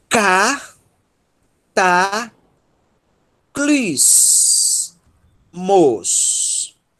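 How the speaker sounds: cataclismos drawn out very slowly, a pause after each syllable. Shown as a demonstration. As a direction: break "cataclismos" into four long, separate syllables. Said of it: "vou começar a ler um pouco mais rápido".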